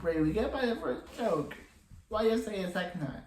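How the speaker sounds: high, fast exasperated complaint. Retold or imitated. Viewer griping at Ray for repeating his joke, exasperated. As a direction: pitch it up and rush the complaint, fed up.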